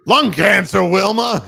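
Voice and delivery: high, loud cartoon cry. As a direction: shout it like a cartoon husband. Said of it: "it's like".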